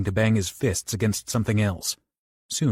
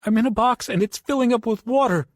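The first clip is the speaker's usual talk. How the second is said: much higher, louder terrified cry; crying in fear; 13 semitones higher, 4 dB louder than usual. Acted. Trapped Bobby cries to Johnny as water rises, high terror. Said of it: "Bobby cried".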